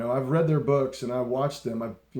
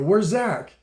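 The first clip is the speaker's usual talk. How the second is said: higher, louder retold question; retold calling out; 7 semitones higher, 6 dB louder than usual. Fan asks rock stars on the bus, calling out. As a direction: call it out as a reenacted question.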